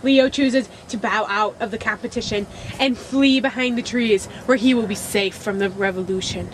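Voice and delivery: dramatic reality show recap voice; recap narrator. Acted.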